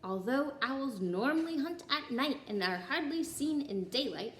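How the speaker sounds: ordinary delivery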